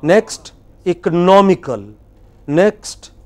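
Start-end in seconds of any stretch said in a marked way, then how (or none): 0.9-1.9 ecoNOMical, loud on NOM; teacher's clear ecoNOMical; stress on third syllable. Shown as a demonstration. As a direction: model "economical" leaning hard on NOM. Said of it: "You see that how it changes?".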